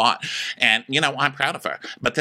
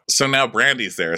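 ordinary talk

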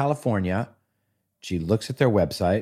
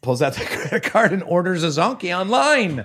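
higher, laughing while talking; 10 semitones higher than usual. Real, not acted. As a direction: laugh through the line. Laughing through the story.

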